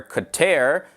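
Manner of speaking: Qatar said as kuh-TAIR; variant Qatar pronunciation. Shown as a demonstration. Listing common ways to say Qatar, pronounced kuh-TAIR. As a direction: end Qatar on an "air" sound. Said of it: "common ways of pronouncing it are".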